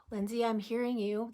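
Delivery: gentle affirmation spoken inward; soft self-talk. Shown as a demonstration. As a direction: murmur it to yourself kindly. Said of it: "This is what you're gonna tell yourself".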